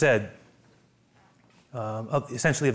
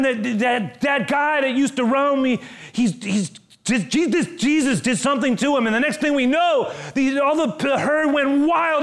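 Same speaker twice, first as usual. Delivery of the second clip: excited townsfolk; 9 semitones higher, 3 dB louder than usual. Acted. Townspeople retell the pig stampede.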